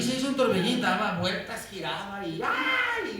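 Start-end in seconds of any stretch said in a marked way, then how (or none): 2.4-3.2 very high wailing "ay"; preacher mimicking a tantrum cry; mock child shriek. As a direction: shriek like a spoiled kid. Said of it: "gritaba así".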